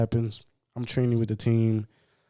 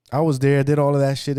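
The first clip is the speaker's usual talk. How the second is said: emphatic excitement; 6 semitones higher, 10 dB louder than usual. Real, not acted. Emphatic telling.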